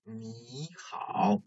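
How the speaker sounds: 你好 said slowly with 你 rising like a second tone; slow 你好, 你 lifted to a rising tone; tone sandhi. Shown as a demonstration. Teacher showing students the tone change in 你好, slow and rising on 你. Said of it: "But as you hear, it is read as".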